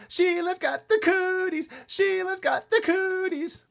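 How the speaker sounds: very high, slow shouted taunt; really loud holler. A narrator's reading. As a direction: yell a playground chant at full voice. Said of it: "We hollered really loud".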